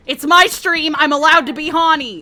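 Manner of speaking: loud, high-pitched declaration; indignant protest. Real, not acted. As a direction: shout it in self-defence. Loudly protesting on own stream.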